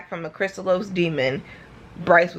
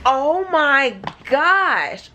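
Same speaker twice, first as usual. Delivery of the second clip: stunned gasp; 4 semitones higher, much slower than usual. Real, not acted. Shocked reaction to book twist.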